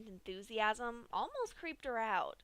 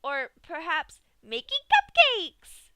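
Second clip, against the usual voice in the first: much louder, slow sing-song announcement; happy Pinkie; 7 semitones higher, 16 dB louder, slower than usual. A narrator's reading. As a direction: announce it proudly, beaming. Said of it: "Pinkie happily announced".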